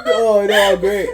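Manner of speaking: high breathless laugh-talk. Real, not acted. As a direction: answer while still giggling. Still laughing.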